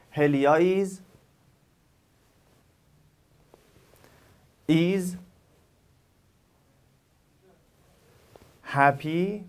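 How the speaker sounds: "هلیا is", "is", "happy" each followed by a long gap; pauses for repetition. Shown as a demonstration. Teacher asking learners to repeat the parts, long pauses between words. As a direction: model "هلیا is happy" piece by piece, leaving long silences to repeat. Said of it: "شما چهار تا رو تکرار کنید".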